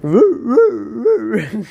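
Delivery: high hooting woo-woo, like a hound; imitating the dog's bark. Friend's odd-barking hound imitated, high hooting. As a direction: howl it out like a silly hound dog. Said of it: "the way he barks too".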